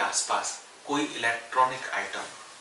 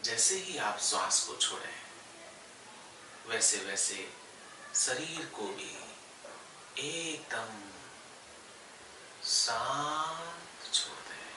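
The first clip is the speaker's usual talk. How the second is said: unhurried yoga nidra guidance with long pauses; guided meditation; 5 dB quieter than usual. Real, not acted. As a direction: guide them gently into stillness, phrase by phrase. Leads yoga nidra relaxation. (ठहर-ठहर कर योग निद्रा का निर्देश)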